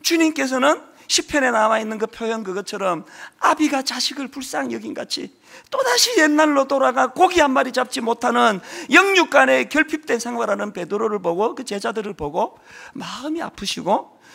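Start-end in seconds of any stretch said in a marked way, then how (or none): none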